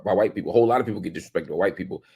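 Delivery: normal talking voice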